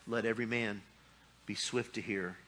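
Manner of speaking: slow, soft scripture recitation; scripture reading. Real, not acted. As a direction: let the verse settle slowly. Preacher repeats scripture.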